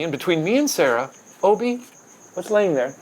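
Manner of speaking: ordinary talk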